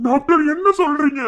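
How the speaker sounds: loud, high shocked outburst; alarmed cry. Acted. Brother hears his sister is critical, alarmed shout.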